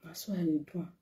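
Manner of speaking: breathy whispered repeat of na so I dey do am. Real, not acted. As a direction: drop to a hushed whisper, as if sharing a secret.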